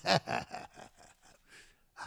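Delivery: big hearty laugh, breaking into breathy cackle; mock belly laugh. Fat man with cigar laughing at the duck walk, big hearty mock laugh.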